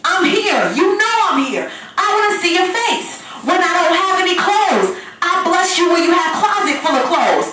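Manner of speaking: usual speaking voice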